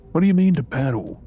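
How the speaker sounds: quick startled demand; alarmed disbelief. Acted. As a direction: catch yourself, suddenly alarmed. Learns army marches on his people.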